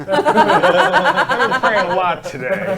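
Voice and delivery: laughing through the words. Real, not acted. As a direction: laugh while speaking. Joke while laughing.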